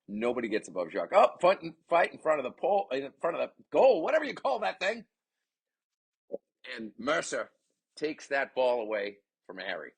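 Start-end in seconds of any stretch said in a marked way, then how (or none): 1.1-5.1 loud, high call of a scramble; urgent sportscaster, pitch climbing; excited commentary. Real, not acted. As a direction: tumble over the words in excitement. Scramble in front of goal.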